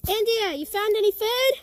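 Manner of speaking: loud call across a distance. Real, not acted. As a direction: shout to someone far away. Calling out to a friend far off.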